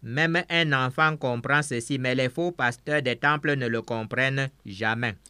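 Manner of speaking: usual speaking voice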